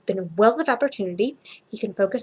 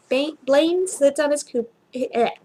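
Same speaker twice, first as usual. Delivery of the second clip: ordinary delivery, nothing marked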